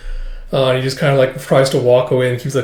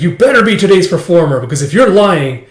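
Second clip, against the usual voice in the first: higher, louder scolding threat; mock threat; 6 semitones higher, 7 dB louder than usual. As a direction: warn him off sharply. Threatening the newcomer.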